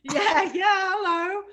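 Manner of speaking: high, loud gleeful yelling; a loud squeal of agreement; excited cheer. Real, not acted. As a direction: cheer her on loudly.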